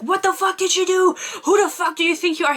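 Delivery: high-pitched indignant outburst; mock angry yell. Retelling him rushing to her house, mock angry yell. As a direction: imitate him yelling in outrage. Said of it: "He, like, yells at her".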